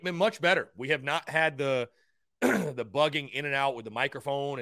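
usual speaking voice

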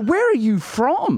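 high, drawn-out bewildered question; baffled demand. Retold or imitated. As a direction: ask it slowly in mock bafflement. Baffled retort to fickle accent.

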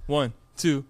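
ordinary talk, nothing marked

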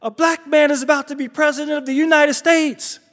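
high, awed announcement; lightly voiced emotional neighbor; overwhelmed neighbor. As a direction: say it like history is happening. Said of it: "She said".

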